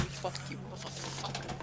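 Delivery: whisper. Real, not acted. Player whispering curses.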